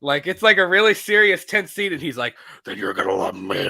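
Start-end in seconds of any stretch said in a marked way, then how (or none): 2.6-3.7 deep, garbled growl dissolving into gibberish; mock the overdone low superhero voice. Comic mocking Batman's voice in a tense scene, deep garbled growl. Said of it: "he needed to change his voice".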